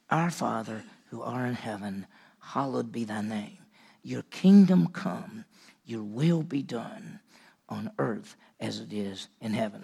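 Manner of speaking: slow, soft, reverent recital of the prayer; prayer recitation. Acted. Preacher reciting the Lord's Prayer to the church, slow and soft. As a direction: pray it slowly under the breath.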